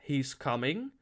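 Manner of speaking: ordinary delivery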